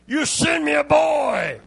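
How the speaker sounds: put-on sneering Goliath bellows at the boy; mocking giant. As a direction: yell it down at David like a scornful giant. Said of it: "what he told David".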